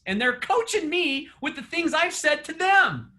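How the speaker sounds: usual speaking voice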